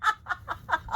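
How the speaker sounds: giggling through the words; laughing. Real, not acted. Playing with new kitten.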